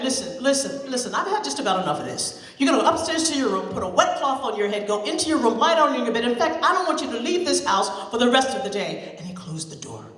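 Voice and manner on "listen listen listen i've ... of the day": loud, firm orders; loud stern dad scolding; stern scolding father. Acted. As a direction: send her to her room.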